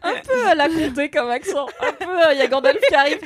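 giggling through the words; teasing while laughing; laughing tease of a friend's bad accent. Real, not acted.

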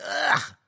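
loud, high groan of revulsion; disgusted ugh. Retold or imitated. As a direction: let out a loud retching "ugh". Disgusted at the stinky pizza.

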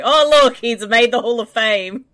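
loud, high excited exclamation; laughing, loud and high. Real, not acted. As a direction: burst out in delighted disbelief. Excited at a photoshop.

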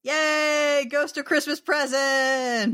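high, drawn-out cheer; excited, high-pitched hooray; gleeful cheer. Pretend. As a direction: cheer it like a delighted kid. Cheering the movie's most cheerful part.

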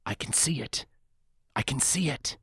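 soft, far-away excited cry; distant mock yell. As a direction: yell it faintly, like a voice echoing in memory. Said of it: "you can almost hear the patron yelling".

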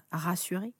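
ordinary delivery, nothing marked

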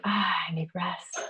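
slow breathy whisper of a worn-out body; tired whisper. Pretend. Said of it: "Your body could say".

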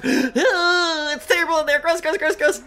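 high, whining revulsion; disgusted. Acted. Acts revulsion on opening eyes.

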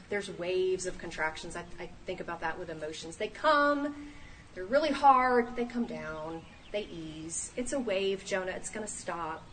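ordinary delivery

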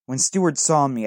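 plain reading voice

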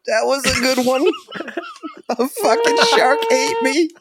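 very high, squealing through laughter; laughing while talking. Real, not acted. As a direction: barely get the words out for giggling. Joking through a fit of laughter.

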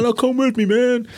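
very high, hammed-up movie pleading; mock urgent plea. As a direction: beg your mate along, in imitation. Voicing character begging mate to come.

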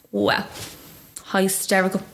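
ordinary talk, nothing marked

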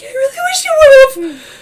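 high, loud mock sobbing; whiny tearful Shauna. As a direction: wail it slowly, imitating a crying sister. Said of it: "Shauna's just like".

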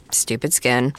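ordinary talk, nothing marked

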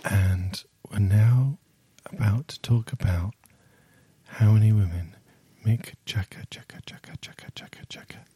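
hushed breathy whisper; ASMR whisper. Pretend. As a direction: whisper close to the mic. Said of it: "You've gone to the A S M R voice".

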